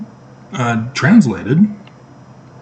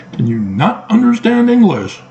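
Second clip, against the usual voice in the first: pitched up high, mock disbelief; incredulous jab at the listener; exasperated scoff; 11 semitones higher than usual. Real, not acted. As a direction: scoff at them like it's obvious.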